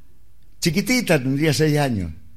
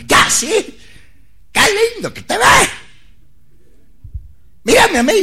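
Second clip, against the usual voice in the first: high falsetto, much higher and louder; the Cuchi's soaring falsetto greeting; 4 dB louder than usual. Retold or imitated. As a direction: sing it out in falsetto. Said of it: "habla así para arriba ... en falsete".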